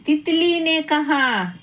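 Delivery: usual speaking voice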